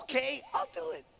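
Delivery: high, soft squeal of agreement; tiny high-pitched eager voice; very excited. Acted. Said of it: "She's very excited".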